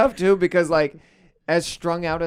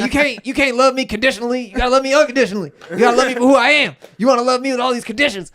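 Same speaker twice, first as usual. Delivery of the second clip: fast, higher heated rant; 6 semitones higher, 5 dB louder, faster than usual. Retold or imitated. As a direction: rattle it off like a wounded father. [name] rants at son.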